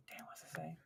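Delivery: whispered aside to self; whispering. Real, not acted. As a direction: whisper it under your breath. Muttered aside while reading.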